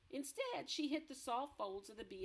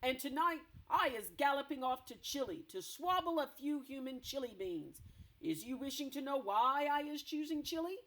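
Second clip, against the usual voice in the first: loud, gloating giant boast; boasting brute giant; 5 semitones higher, 6 dB louder than usual. Acted. Blood Bottler boasting of Chile to BFG, loud gloat. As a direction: gloat loudly about his plans.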